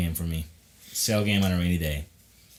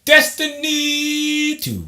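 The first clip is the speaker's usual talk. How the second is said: announcer voice; 5 dB louder, much slower than usual. Acted. Dramatic reveal of the topic.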